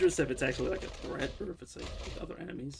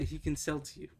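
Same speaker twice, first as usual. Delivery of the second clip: normal talking voice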